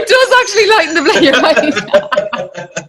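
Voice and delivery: high, loud, through laughter; laughing agreement. Real, not acted. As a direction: crack up while conceding the point. Both crack up at joke.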